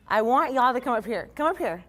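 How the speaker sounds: high, loud calling out; yelling across the room. Real, not acted. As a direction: call them up from across the room. Calling her teammates up.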